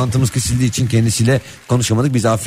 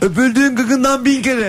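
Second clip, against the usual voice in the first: put-on high sweet child voice; 10 semitones higher, slower than usual. Child flattering mother.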